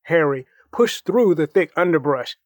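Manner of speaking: ordinary delivery, nothing marked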